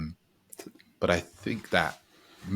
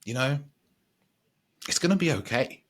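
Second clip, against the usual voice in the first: higher, soothing self-reassurance; reassuring himself; 6 semitones higher than usual. Retold or imitated. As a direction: talk yourself down gently, a bit higher. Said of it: "and I just said".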